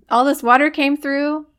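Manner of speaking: a hint of a talking frog. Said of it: "imagine this all in a very, like, frog voice".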